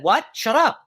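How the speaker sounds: sudden high, loud outburst; a bossy inner voice snapping back; big loud voice. Retold or imitated. The ego voice in her head shouts her down, high and loud. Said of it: "And the big loud voice said".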